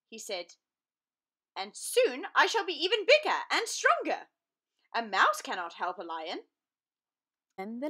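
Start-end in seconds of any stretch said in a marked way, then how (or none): 1.5-6.5 boastful lion. Acted.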